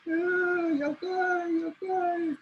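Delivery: very high, wailing cry; crying coyote. Acted. Coyote cries high and wailing over his eyes. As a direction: wail in mock tears. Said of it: "see him crying".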